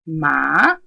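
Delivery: second-tone "ma", climbing at the end. Shown as a demonstration. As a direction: lift "ma" steadily upward. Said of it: "However, if I said ma".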